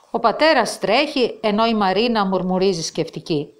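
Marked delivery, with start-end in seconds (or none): none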